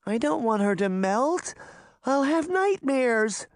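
put-on a small girl frightened of the melting; little girl voice. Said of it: "Daphne said".

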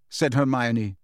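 ordinary delivery

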